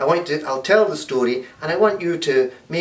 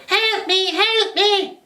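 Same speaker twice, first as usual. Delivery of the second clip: very high, thin cries for help; Granny stuck in the cupboard calling; slower than usual. Acted. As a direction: call out like a trapped old lady. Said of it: "they heard".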